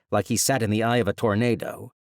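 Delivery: usual speaking voice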